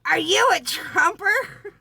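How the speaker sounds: high, loud, slow mock accusation of an old woman; accusing old lady. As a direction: squawk it like a tiny furious grandma. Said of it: "she's going".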